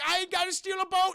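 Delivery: high-pitched urgent hero voice; high, eager imitated declaration; mock-heroic urgency. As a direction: proclaim the plan in hammed-up haste. Hero announces an urgent plan.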